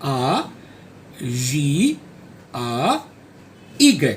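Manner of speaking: A J A Y spelled out slowly in French letter names; French spelling. Shown as a demonstration. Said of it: "यही नाम मैं जब French alphabets में". (French में धीरे-धीरे नाम की स्पेलिंग)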